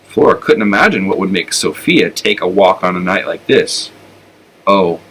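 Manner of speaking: plain reading voice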